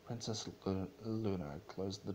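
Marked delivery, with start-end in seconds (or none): none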